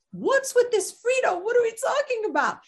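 high-pitched baffled questioning; acted bewilderment. Acts out her bewilderment at name.

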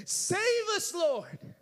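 high, loud plea of a crowd; crowd shouting. Acted. Crowd shouting to Jesus on the donkey, loud plea. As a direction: cry out to Jesus as he rides by. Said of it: "they were shouting to Jesus".

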